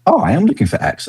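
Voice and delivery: high-pitched, mock-delighted realisation; pleasant surprise. Website visitor finding the right place, pleasantly surprised.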